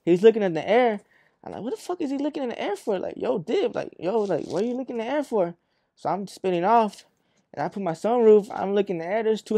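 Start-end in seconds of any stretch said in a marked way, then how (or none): 1.6-3.0 high, quick incredulous question; retold bafflement. Driver watching his brother look upward, high baffled disbelief. 3.2-5.5 higher-pitched puzzled urging; retold urgent bafflement. Driver telling his brother to take off, high baffled urging.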